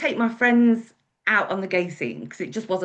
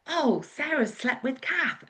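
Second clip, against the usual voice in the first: joking tease; 6 semitones higher, slower than usual. Retold or imitated. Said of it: "as a joke".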